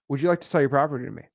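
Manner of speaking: smooth, fast, easy ask; confident. Retold or imitated. Seasoned wholesaler asking a seller, fast and confident. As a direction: rattle it off like you've done it thousands of times. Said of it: "they're gonna be like".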